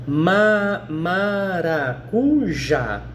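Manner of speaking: "maracujá" very slowly split into syllables, ending on a clear JÁ; stress on last syllable. Shown as a demonstration. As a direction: break "maracujá" apart slowly to show the final JÁ. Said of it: "não é a última?". ("maracujá" sílaba por sílaba, bem devagar, destacando o JÁ)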